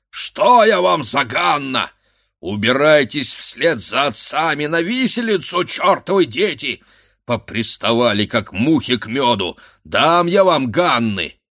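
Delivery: blustering village headman's tirade; angry shout. A narrator's reading. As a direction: chase the lads off furiously. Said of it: "кричал голова, отбиваясь".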